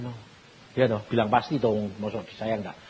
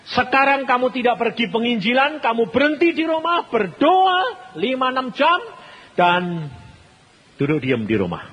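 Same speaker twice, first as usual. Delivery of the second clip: raised, loud voice of God giving orders; God's command; 11 semitones higher, 6 dB louder than usual. Retold or imitated. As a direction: proclaim it high and loud as God speaking. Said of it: "Tuhan mengatakan". (suara Tuhan memerintah dengan lantang)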